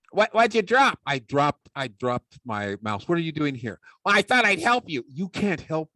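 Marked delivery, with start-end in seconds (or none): 0.1-1.0 puppet sidekick. Acted. Ventriloquist puppet heckles the speaker. 4.0-5.0 puppet sidekick. Acted. Puppet offers help.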